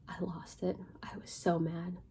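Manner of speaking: intense whisper, held back; whispered fury. Real, not acted. Admitting she lost it with Walter, tense whisper. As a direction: whisper it like rage barely contained.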